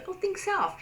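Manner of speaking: high breezy "Oh, think self"; light and carefree. Shown as a demonstration. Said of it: "make sure that your facial expressions are".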